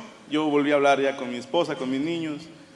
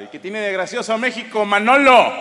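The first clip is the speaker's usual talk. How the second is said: mock challenge; 4 semitones higher, 7 dB louder than usual. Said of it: "Y ahí voy todo cagado".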